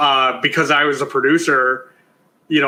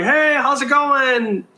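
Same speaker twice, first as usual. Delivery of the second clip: high, slow chirpy greeting imitation; friendly greeting; 9 semitones higher, slower than usual. Retelling how the stars first greeted her, friendly high voice. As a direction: mimic the celebs' sunny hello.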